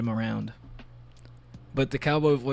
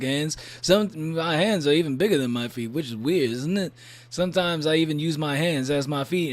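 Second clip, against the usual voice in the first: louder, hammed-up cowboy character; cowboy Furby voice; 6 dB louder than usual. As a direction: brag like a cartoon cowboy toy. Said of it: "That's also my Majima voice".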